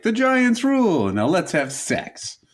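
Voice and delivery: a tough New Yorker, drawn out; New York accent. Pretend. A tough New York lover, slow mock New York accent. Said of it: "Classic New York staples like".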